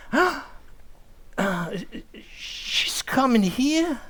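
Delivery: gasp, then drag it out slowly, up high; dawning shock. Acted. Husband realizing his mother-in-law is visiting, in slow dismay. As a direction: let the horror sink in as you ask.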